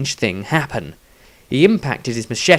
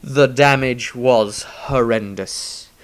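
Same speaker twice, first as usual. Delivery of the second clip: usual speaking voice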